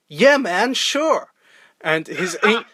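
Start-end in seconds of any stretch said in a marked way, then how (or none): 0.1-1.3 lightly voiced upbeat American reply; a high, breezy yeah-sure; the actor's answer. Imitating the actor agreeing to do an English accent. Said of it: "And he was like".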